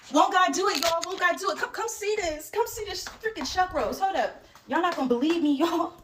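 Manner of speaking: high, loud rejoicing; jubilant. Real, not acted. Storyteller calling viewers to see the chuck roast, high jubilant excitement. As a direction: rejoice and beckon everyone over.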